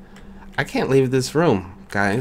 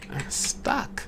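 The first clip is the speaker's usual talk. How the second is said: stuck whisper; 9 semitones higher, 9 dB quieter than usual. Real, not acted. Trapped in the room.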